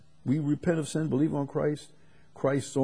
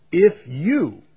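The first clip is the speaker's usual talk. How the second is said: loud, pointed if you; emphatic; 6 semitones higher, 10 dB louder than usual. Real, not acted. Preacher pressing the condition on his hearers, loud. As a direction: punch it at the listeners.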